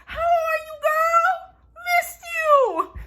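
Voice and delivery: very high, drawn-out squeal; hammed-up squeal of a delighted reunion; mock excited greeting. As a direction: shriek it in a silly, sky-high voice. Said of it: "he didn't say it like that".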